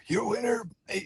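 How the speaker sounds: thin, high, soft failed announcement; voice giving out. Retold or imitated. Announcer in the octagon with nothing left, high failing voice. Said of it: "and literally nothing".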